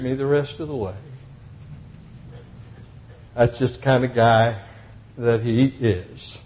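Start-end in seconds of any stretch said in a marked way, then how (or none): none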